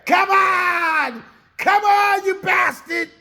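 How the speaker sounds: 高く大声で「Come on」と怒鳴り続ける; 逃げる背中に叫び散らす; furious yell. Retold or imitated. Cookie yells after fleeing boys.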